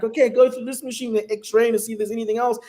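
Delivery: high-pitched mock checkpoint instructions; American security officer. US guard sending a traveller through the X-ray, officious imitation. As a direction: imitate a guard reeling off procedures. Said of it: "and it's like".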